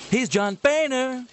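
high, slow, hammed-up announcer; mock announcer voice. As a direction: say his name with mock grandeur. Mocking the Boehner clip.

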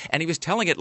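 usual speaking voice

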